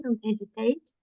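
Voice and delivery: usual speaking voice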